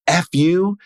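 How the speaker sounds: a sharp F you, pitched high; a short burst of anger; retold angry outburst. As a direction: spit it at his dad. Angry outburst at his father.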